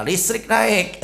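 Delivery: high-pitched mock outcry; hammed-up cry of protest; mock complaining yell. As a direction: imitate parents yelling in complaint. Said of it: "Besok dua-duanya teriak".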